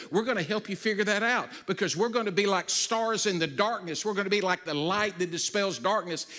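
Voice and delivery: loud, driving sermon voice, pressing each line home; rally the scattered church with fire; preaching at full force. Acted. Pastor urging the congregation at home to be the church, loud preaching.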